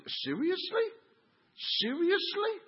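high, rising disbelief; incredulous. Real, not acted. Pastor baffled at people leaving over chairs, incredulous. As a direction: squeak the question upward in disbelief.

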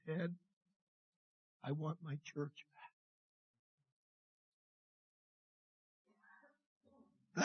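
hushed voice. Retold or imitated. God's voice heard, hushed.

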